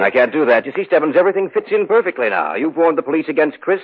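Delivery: gloating villain. Acted. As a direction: savour every word of the plan. Killer reveals plan to victim.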